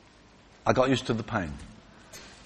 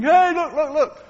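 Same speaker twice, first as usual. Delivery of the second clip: high, loud cheering "look, look"; whooping; 13 semitones higher, 5 dB louder, slower than usual. Retold or imitated. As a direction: whoop it out like delighted friends. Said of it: "her friends are all whooping".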